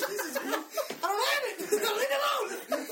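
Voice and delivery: high, loud laughing yell of protest; laughing shout. Retold or imitated. Retold panicked reply to caller.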